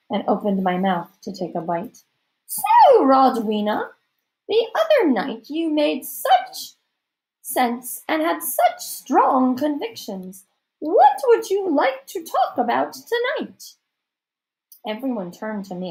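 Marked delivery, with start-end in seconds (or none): 2.5-13.7 slow, high, theatrical society lady; hammed-up matronly hostess, high and slow; grand hostess. A narrator's reading. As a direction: address the table in drawn-out, lofty tones.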